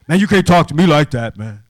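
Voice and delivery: imitating a loud, high-pitched protest; mock an indignant young man talking back; defiant challenge. Boy challenging the speaker.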